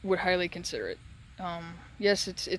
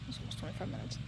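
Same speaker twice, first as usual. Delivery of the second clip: breathy whisper to himself; whispered aside; 11 dB quieter, faster than usual. Real, not acted. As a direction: mutter it under your breath. Quiet aside to self.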